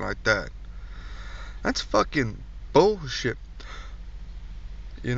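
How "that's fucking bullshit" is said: ordinary delivery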